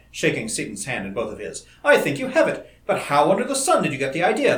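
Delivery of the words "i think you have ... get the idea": high, loud, delighted astonishment; amazed and joyful. Acted. Colleague shaking the inventor's hand, amazed and overjoyed. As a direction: gush with wonder at the breakthrough. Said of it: "changed to amazement and then to joy".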